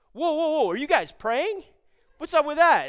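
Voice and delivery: loud, high, incredulous challenge; startled stranger interrupting. Retold or imitated. Stranger stops praying men mid-prayer, loud and startled. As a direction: cut in loudly, as if stopping someone short.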